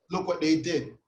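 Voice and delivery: usual speaking voice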